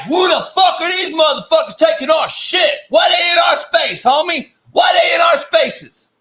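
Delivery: high indignant shout; ranting shout. Real, not acted. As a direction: yell it like you're outraged. Ranting about intruders.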